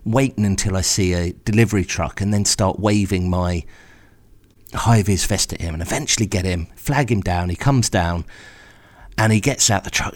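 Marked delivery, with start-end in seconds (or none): none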